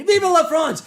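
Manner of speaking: loud high cry of Vive la France; loud patriotic cry; cheering shout. Retold or imitated. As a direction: shout it with pride. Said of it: "zoom in on the pretty lady".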